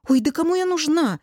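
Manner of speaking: high, dismissive exclamation; irritated. A narrator's reading. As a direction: brush off the concern with annoyance. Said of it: "отмахнулась раздражённо". (раздражённо отмахиваясь)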